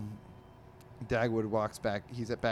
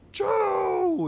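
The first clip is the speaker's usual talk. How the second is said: loud, high-pitched "choo" sneeze; mock sneeze; 10 dB louder than usual. Podcaster voicing cat's sneeze from a comic, loud mock sneeze. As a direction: burst out a big cartoon sneeze. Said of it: "He's sneezing, obviously".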